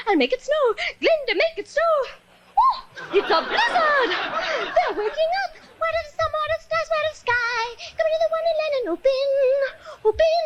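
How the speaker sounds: high airy good-witch voice; high sweet witch voice; Glinda voice. Acted. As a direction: float it up like a fairy.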